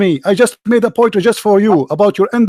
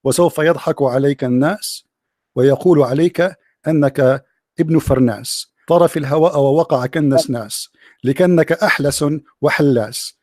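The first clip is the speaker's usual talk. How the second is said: poem recitation; 6 semitones lower than usual. Acted. Recites improvised classical Arabic poem.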